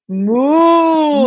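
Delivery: long, loud, high cow moo. Shown as a demonstration. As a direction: moo big and long for a child. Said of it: "puede sacar la vaquita y decir". (mugido de vaca largo y fuerte)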